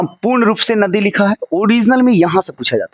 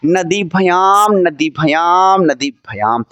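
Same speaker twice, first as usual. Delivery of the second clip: नदीभ्याम said very slowly, syllable by syllable; slow drill; much slower than usual. Shown as a demonstration. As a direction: stretch out नदीभ्याम for the class to repeat. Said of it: "फिर से बोलो मेरे साथ". (नदीभ्याम को धीरे-धीरे बोलना)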